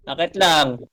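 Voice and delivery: usual speaking voice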